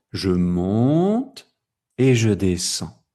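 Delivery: "Je monte" sliding gradually up, "et je descends" dropping back; rise then fall. Shown as a demonstration. Teacher illustrating intonation, rising then falling.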